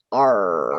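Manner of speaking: low pirate arr. Pretend. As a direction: growl "R" like a pirate. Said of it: "pirate's favorite letter".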